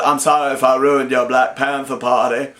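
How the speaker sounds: imitating a gentle, simple Forrest Gump. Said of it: "he turns around and goes".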